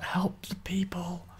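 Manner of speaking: slow, very soft imitated whisper; whispered plea. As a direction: whisper it like a faint dying plea. Quotes a dying hero's plea.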